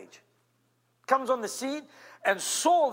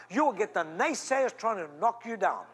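usual speaking voice

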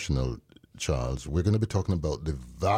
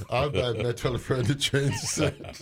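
laughing while talking; 6 semitones higher than usual. Real, not acted. Laughing through punchline.

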